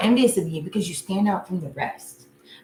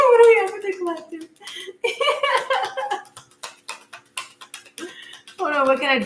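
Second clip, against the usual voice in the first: laughing through words; 11 semitones higher, faster than usual. Real, not acted. Reader returning to the cards after the dog howl, still laughing. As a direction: push the question out through laughter.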